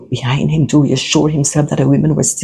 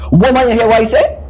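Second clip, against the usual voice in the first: higher-pitched, indignant command; asserting authority; 8 semitones higher, 3 dB louder, faster than usual. Acted. Maurice hides his fear from his partner, asserting authority. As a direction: demand obedience, pitch jumping up. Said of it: "establishing his authority".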